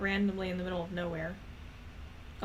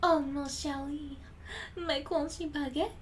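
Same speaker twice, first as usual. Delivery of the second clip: put-on French girl accent; 5 semitones higher, 4 dB quieter than usual.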